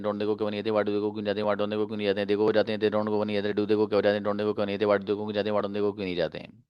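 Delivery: fast monotone conjugation chant; low, even, breakneck recitation; rapid-fire drill. Shown as a demonstration. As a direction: race through it without pausing for breath. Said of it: "दे की भी पढ़ी जा सकती है".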